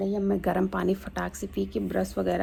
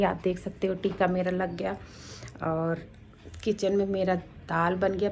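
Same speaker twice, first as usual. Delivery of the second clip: usual speaking voice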